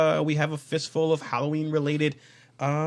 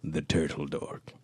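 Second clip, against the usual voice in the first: low breathy podcast whisper; whispered intro; 12 semitones lower, slower than usual. Acted. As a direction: whisper the welcome slowly. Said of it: "I have to be in that voice".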